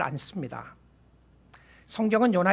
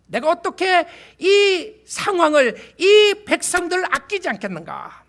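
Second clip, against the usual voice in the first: louder and higher, pleading; passionate plea; 9 semitones higher, 9 dB louder than usual. Acted. Preacher voicing God's plea over Nineveh, loud and passionate.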